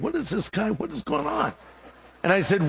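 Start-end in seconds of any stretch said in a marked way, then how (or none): 0.0-1.5 high, softened mock bewilderment; baffled inner voice. Preacher retelling his thoughts about the stranger, baffled. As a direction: think aloud, pitch climbing in disbelief. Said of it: "I'm thinking".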